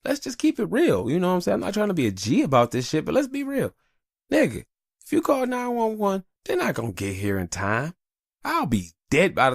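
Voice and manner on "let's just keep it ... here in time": very high, hammed-up pleading; imitating himself arguing with his wife; mock high protest. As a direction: argue back in a silly high voice. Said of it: "And I'm like".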